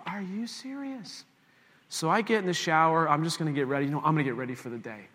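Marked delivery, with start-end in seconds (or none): 0.1-1.3 high, soft, drawn-out disbelief; incredulous. Retold or imitated. Husband told the baby is not crying, incredulous. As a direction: stretch it out high in mock disbelief.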